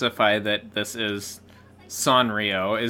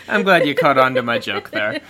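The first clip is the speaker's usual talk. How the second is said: laughing; 3 semitones higher, 7 dB louder than usual. Real, not acted. Laughing after the joke.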